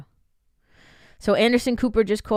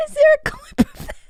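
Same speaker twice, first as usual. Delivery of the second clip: laughing; 13 semitones higher, faster than usual. Real, not acted. Still laughing after the joke.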